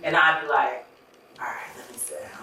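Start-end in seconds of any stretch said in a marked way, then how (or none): 1.4-2.4 hushed, near-whispered compliance; retold sheepish murmur; meek giving in. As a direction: back down quietly, as if told off.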